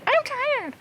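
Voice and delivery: high copycat echo of "I'm tired"; mimicking little brother. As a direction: parrot it back high and teasing. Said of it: "He'd go".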